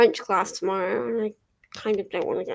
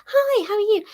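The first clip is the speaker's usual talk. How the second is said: cheery shop greeting; 10 semitones higher, 3 dB louder than usual. Retold or imitated. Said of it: "She's like".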